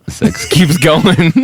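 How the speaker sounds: loud, slow, laughing; loud punchline. Acted. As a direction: belt the catchphrase slowly.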